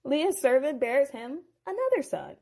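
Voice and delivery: plain reading voice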